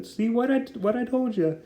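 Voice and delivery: a hint of a smug small guy teasing his friend; little troll voice. Troll voiced in retold comic scene.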